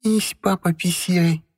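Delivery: put-on high baby talk, joyful; chirping toddler. A narrator's reading. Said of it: "радостно прощебетала Кроха".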